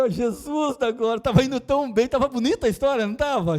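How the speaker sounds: high, loud mock alarm at the offered wine; hammed-up dismay, crying out to Jesus; comic panic. Pastor mock-panicking to the congregation, high and loud.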